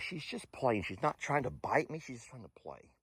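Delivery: soft explanation that the pet only plays; quiet aside. Real, not acted. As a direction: drop the voice to almost nothing. Soft aside about the animal.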